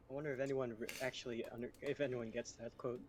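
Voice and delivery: ordinary talk, nothing marked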